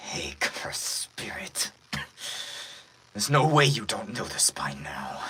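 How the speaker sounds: whispered villain voice; breathy villain. Acted. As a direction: rasp the threat on the breath. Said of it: "real quiet villain voice that I do".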